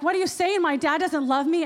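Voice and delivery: a replayed flash of anger at the speaker; retold angry outburst. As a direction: fire it back in disbelief. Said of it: "And I got so angry".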